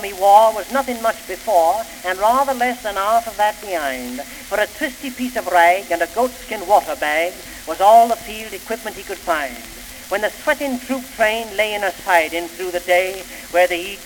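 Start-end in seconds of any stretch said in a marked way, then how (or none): none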